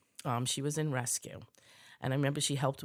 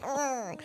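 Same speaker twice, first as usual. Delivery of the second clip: high-pitched retold "ugh"; exasperated groan; 11 semitones higher than usual. Retelling hearing the babysitter got sick, exasperated high groan. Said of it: "I'm like".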